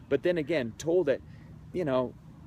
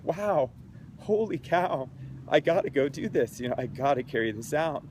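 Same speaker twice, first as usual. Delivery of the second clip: moved amazement; 3 semitones higher than usual. Retold or imitated. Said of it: "I'm getting all choked up".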